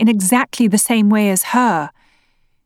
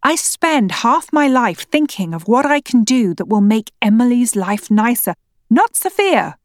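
incredulous anger; 4 semitones higher than usual. Acted. Wife shouting back in quarrel.

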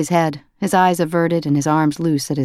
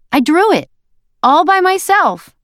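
put-on proud toddler; 13 semitones higher, 5 dB louder than usual. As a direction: insist proudly like a small child. Toddler voiced by narrator.

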